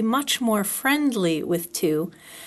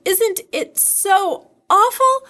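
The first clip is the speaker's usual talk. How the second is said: high, slow, drawn-out dread; frightened Piglet; 9 semitones higher, 5 dB louder, slower than usual. A narrator's reading. Piglet holds Christopher Robin's hand tight, frightened. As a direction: wail it slowly, rising high.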